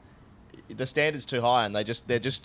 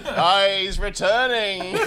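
higher, louder, slow mock broadcast; laughing through a mock town-crier call; megaphone announcement; 9 semitones higher, 8 dB louder, much slower than usual. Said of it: "we heard it through a megaphone".

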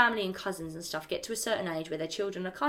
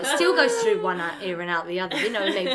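laughing; 7 dB louder than usual. Real, not acted. Laughing at being told off.

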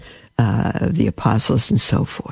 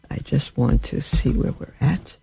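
whispering; 4 dB quieter than usual. Real, not acted. Aside while checking tech.

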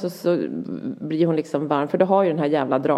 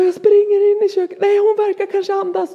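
high, loud, breathless-sounding panic imitation; retold excited wail; frantic child; 6 dB louder than usual. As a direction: blurt it like an overwrought boy on the phone. Son reporting in panic.

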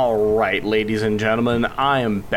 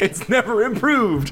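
laughing; 9 semitones higher than usual. Real, not acted. Echoing with a laugh.

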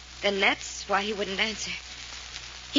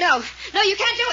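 high, desperate cry, higher; panicked protest; 11 semitones higher, 3 dB louder than usual. Acted. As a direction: cry out in panic to stop him. Fay tries to stop the arrest.

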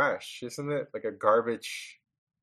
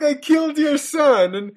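much higher and louder mock outrage; indignant quote; 5 dB louder than usual. Voicing the killer protesting to the grieving father, high loud mock indignation. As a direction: whine it like he's the victim. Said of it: "it's like".

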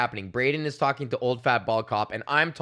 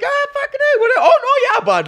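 far higher, louder goofy buddy voice; hammed-up fake-chummy bro; 9 dB louder than usual. Braden schmoozing old cop, hammed-up chummy squeal. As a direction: overplay being friends with the cop. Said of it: "You're like".

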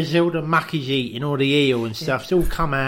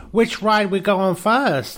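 Kim voice; 6 semitones higher, 4 dB louder, slower than usual. Retold or imitated. Kim voiced in retold story.

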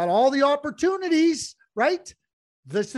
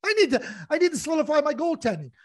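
higher, urgent voice of a GM, fast at the end; imagined GM; 5 semitones higher than usual. Retold or imitated. Host voicing Ken Holland's urgent thinking, higher and hurried. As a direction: play the worried manager needing a goalie. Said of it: "I'm going, like".

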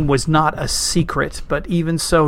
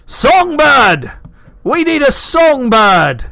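loud high shout across a crowded hall; 9 semitones higher, 8 dB louder than usual. A narrator's reading. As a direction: call out over a noisy feast. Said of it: "a tall man stood up on a table and shouted".

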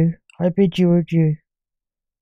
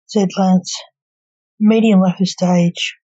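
ordinary delivery

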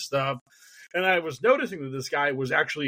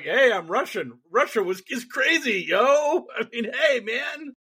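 lightly done, higher Russian-comic accent impression; a hint of a jokey Russian accent, rising higher; Yakov Smirnoff Russian shtick; 8 semitones higher than usual. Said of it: "Yakov Smirnoff's whole shtick".

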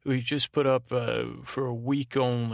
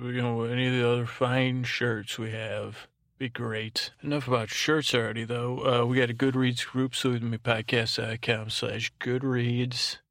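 ordinary talk

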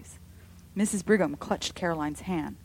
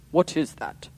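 louder sharp demand; strange voice; 8 dB louder than usual. A narrator's reading. Henry seeing the shadow, demanding in a strange voice. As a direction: demand it, unsettled. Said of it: "he demanded in a strange voice".

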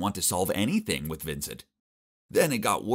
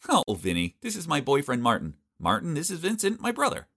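put-on rising higher and higher, breezy girl's voice; Vicky's voice; 7 semitones higher than usual. Vicky introducing boyfriend to her brother, breezy rising voice. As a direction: lightly chirp it out, unbothered.

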